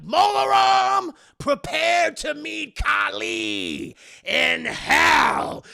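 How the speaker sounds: high, loud, slow shouted vow; hammed-up crazed shout; insane battle cry. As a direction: bellow it like a madman. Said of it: "he looks fucking insane here".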